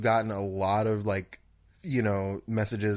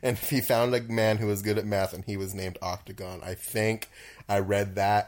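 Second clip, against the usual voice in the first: normal talking voice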